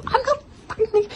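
high, fast mock outburst; exasperated retort. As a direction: fire it back, fed up. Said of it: "I'm like".

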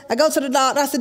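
normal talking voice

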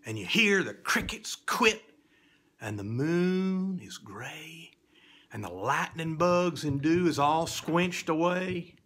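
spooky storyteller's reading, swinging from whisper to loud; theatrical recitation for children; dramatic poem recitation. A narrator's reading. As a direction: tell it like a fireside ghost tale.

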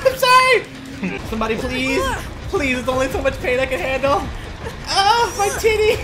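high, shrieky begging; hammed-up pleading. Player on the hook begging for help, mock-agonized wail. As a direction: beg for rescue in mock agony.

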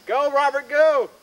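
loud, high cheer; cheering shout. Real, not acted. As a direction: cheer him on loudly. Cheering climber from above.

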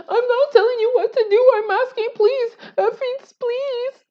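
very high mock begging voice; shrill pleading Trisha. Imitating Trisha begging them, high and squeaky. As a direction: plead in a thin, squeaky falsetto. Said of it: "Elah was also like".

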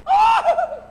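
high-pitched exclamation of shock; startled sea's gasp. Acted. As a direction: cry out high in sudden alarm. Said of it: "el mar que llevaba la ola así dijo".

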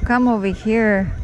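usual speaking voice

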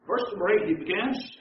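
usual speaking voice

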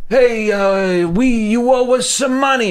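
high-pitched mock mobster voice; mob guy. Mobster demanding money from a comedian, mock mob voice. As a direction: put on a caricatured mobster shakedown. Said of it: "The mob comes up to".

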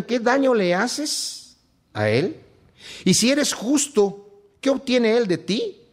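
solemn scripture reading, drawn out; lectura bíblica solemne. Acted. Reads scripture aloud, raised. (lectura solemne y pausada de las Escrituras)

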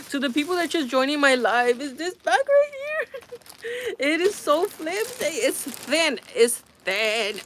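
high, laughing incredulous voice; laughing in disbelief. Real, not acted. Showing live viewers the flimsy bag, laughing in disbelief. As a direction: talk through real laughter, pitch jumping up.

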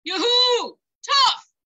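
high, loud yoo-hoo across the bridge; calling loudly. Acted. Ogre calling to the goat across the bridge, loud. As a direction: call out far to get his attention. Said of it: "called loudly".

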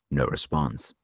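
usual speaking voice

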